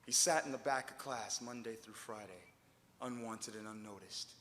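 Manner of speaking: hushed. Acted. Poet retelling Scott's loneliness, hushed and low. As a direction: drop your voice like telling a secret shame. Line repeated, now hushed.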